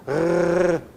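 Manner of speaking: long isolated "rrr" consonant noise; teacher demonstrating a drawn-out "rrr"; sustained R sound. Shown as a demonstration. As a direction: hold the rough Portuguese double-R sound. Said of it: "que é esse aqui".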